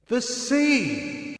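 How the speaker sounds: high amazed exclamation; amazed cry. A narrator's reading. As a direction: cry out at the sight. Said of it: "I cried".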